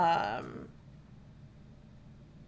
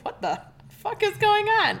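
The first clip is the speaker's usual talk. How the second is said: high, baffled outburst; stunned disbelief, voice jumping up; shocked; 5 dB louder, slower than usual. Retold or imitated. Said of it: "I would've been like".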